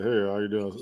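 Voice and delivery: mumbling coworker. Retold or imitated. Imitating a coworker who talks like that, low mumble. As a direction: greet through barely moving lips. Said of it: "coworker who talks like that".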